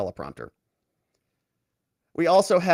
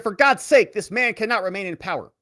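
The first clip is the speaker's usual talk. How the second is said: mock speech shout; 6 semitones higher than usual. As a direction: belt out the first words much higher like a podium speech. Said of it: "He said".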